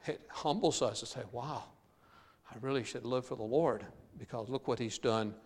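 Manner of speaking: usual speaking voice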